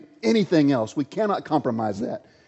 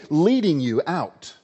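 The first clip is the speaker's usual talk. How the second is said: ordinary talk, nothing marked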